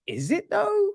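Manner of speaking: high, incredulous "is it though"; mock-sceptical question to the screen; sarcastic doubt. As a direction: pitch it way up in disbelief. Sceptical retort to gushing line.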